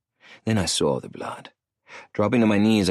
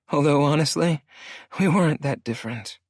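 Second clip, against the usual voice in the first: out of breath, higher woman's voice; gasping for air; 8 semitones higher than usual. A narrator's reading. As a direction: catch your breath between words. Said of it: "she added, gasping for air".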